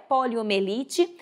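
usual speaking voice

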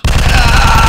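high, loud scream; loud high shriek; startled scream. Real, not acted. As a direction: yell at the scare. Slender appears again.

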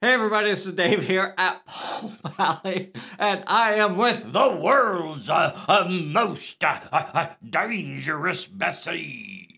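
hammed-up announcer with stuttered build-up; show opening. Host opening the live show, loud hammed-up introduction.

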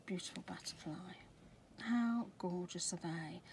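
whispered admiration. Real, not acted. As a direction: breathe the words out in wonder. Admiring stencil.

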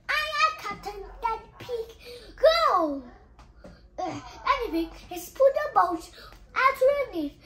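plain reading voice

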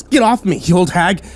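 put-on yelling at the old woman grabbing him; yelled. A narrator's reading. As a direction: shove her off with the words. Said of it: "I yelled".

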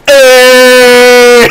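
loud, high, held-out shout; excited cheer. Real, not acted. Streamer celebrating a big moment, excited cheer. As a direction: cheer it out at full voice.

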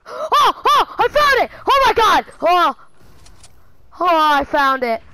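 very high, loud shrieks of triumph; ecstatic screaming. Real, not acted. Gamer finally finding the gun, ecstatic screaming. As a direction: scream with joy, easing slightly at the end.

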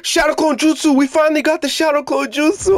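thrilled, high shout of a new move; excited shout. Real, not acted. Gamer shouting at unlocking a new move, thrilled. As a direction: yell it out in triumph.

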